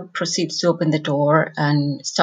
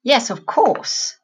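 slow, higher, mock-agreeing "Yes, of course"; a sarcastic brother, translated; 6 semitones higher, slower than usual. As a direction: let the sarcasm drip, taking your time. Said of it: "saying in a sarcastic way".

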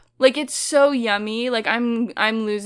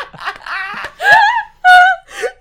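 high, loud howling laughter; real screaming laugh; shrieking laugh; 10 dB louder than usual. Real, not acted. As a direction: crack up completely. Cracking up at the scene.

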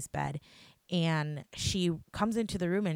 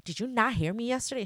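retold indignant, higher-pitched demand; mad at friend; 7 semitones higher than usual. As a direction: imitate an indignant confrontation, pitched up. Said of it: "I was so mad at Shua".

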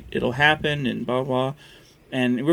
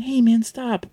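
casual friendly warning; 9 semitones higher, slower than usual. Retold or imitated. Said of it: "it would have been".

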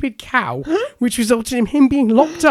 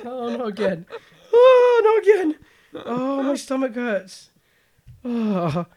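breathless, still giggling; gasping through leftover laughter; after laughing hard; 4 semitones higher, slower than usual. Real, not acted. Can't stop laughing after reading.

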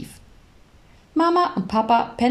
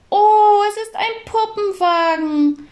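much higher, louder gasp of joy; a toddler thrilled by her present; high excited child's oh; delighted little girl; 13 semitones higher, 5 dB louder than usual. Acted. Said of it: "freute sich Clara".

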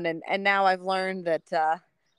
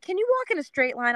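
higher official question; cop voice; 12 semitones higher than usual. Retold or imitated. Police friend testing the neighbor, higher. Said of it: "Shantae's like".

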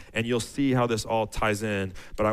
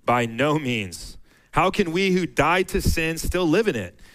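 scripture reading; 4 semitones higher than usual. Acted. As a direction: give each question space, then press on. Reads Romans six aloud.

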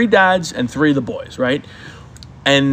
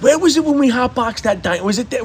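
future reminiscing; 8 semitones higher than usual. Retold or imitated. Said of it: "we're gonna be like".

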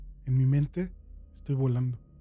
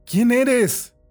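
urgent call; 9 semitones higher, 8 dB louder than usual. Acted. Prisoner calls to unseen voice.

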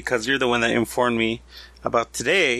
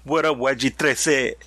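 Spanish accent. Shown as a demonstration. Friends joking about names, Spanish accent. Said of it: "you have to say it like".

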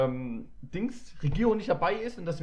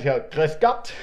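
hammed-up Bavarian phone greeting; mock Bavarian greeting; 6 dB louder, much slower than usual. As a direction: boom out a jokey Bavarian welcome. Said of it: "Wär ich in Bayern".

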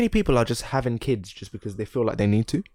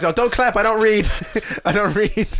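mock comedian; 9 semitones higher, 3 dB louder than usual. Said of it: "He was like".

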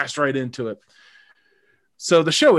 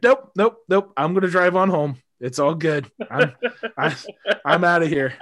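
higher, louder quick brush-off; eager refusal; 6 semitones higher, 5 dB louder than usual. Retold or imitated. Date refusing to stay over, higher quick refusal. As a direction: get out fast, cheerfully. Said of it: "she's like".

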